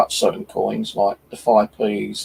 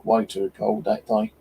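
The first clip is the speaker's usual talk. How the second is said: normal talking voice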